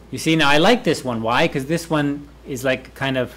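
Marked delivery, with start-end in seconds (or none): none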